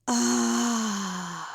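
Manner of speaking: long exhale let out as a sigh; audible sigh. Shown as a demonstration. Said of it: "Let it be a sigh".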